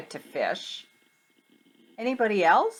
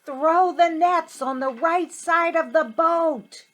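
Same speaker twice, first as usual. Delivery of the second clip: higher and louder call across the water; 10 semitones higher, 6 dB louder than usual. Acted. Jesus calling from the shore to the boat, yelled. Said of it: "he yelled back at them".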